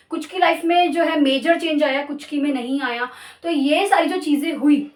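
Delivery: normal talking voice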